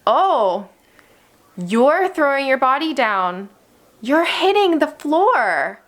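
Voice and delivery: high, slow, gentle narrating of the tantrum; calm sportscasting. Shown as a demonstration. Teacher calmly narrating a child's tantrum, high and slow. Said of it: "you're saying".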